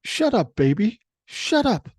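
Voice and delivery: high-pitched, punchy mock scolding; imitated waitress. Waitress bending over a noisy toddler, high imitated scolding. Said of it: "she didn't have command of the English language".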